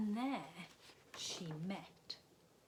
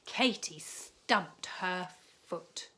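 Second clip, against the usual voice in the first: usual speaking voice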